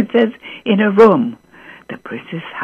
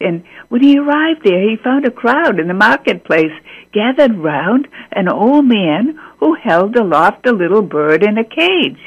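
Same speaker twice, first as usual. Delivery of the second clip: ordinary delivery, nothing marked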